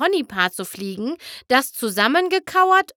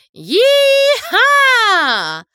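high, loud drawn-out yeehaw; cowboy whoop; 14 semitones higher, 8 dB louder than usual. Acted. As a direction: holler like a rodeo rider. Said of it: "rief Applejack". (lauter Cowboy-Jubelruf)

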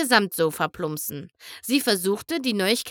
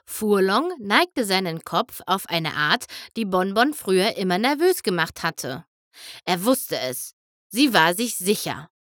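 usual speaking voice

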